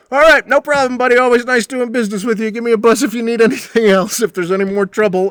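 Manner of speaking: high, loud, hammed-up cocky tough guy. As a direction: play him breezy and pleased with himself. Blanton voiced, cheerful exit.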